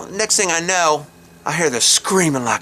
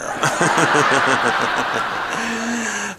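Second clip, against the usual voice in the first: long breaking-up laugh; hearty laugh; 5 semitones higher than usual. Real, not acted. Storyteller picturing crap everywhere, long hearty laugh. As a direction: crack up at your own story.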